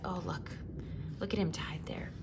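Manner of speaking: soft, breathy sorrowful whisper; hushed whisper. Acted. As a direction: whisper as if watching in secret. Narrator whispers aside.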